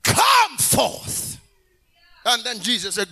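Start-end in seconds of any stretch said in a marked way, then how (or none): 0.0-1.4 high, long, full-force summons; loud-voiced shout. Preacher as Jesus raising Lazarus, high drawn-out shout. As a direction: holler the name slowly, then command. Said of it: "he shouted with a loud voice".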